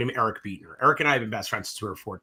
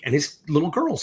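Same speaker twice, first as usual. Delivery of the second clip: usual speaking voice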